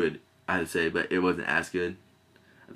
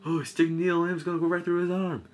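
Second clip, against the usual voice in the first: mock alarm; 11 semitones higher than usual. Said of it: "He's like".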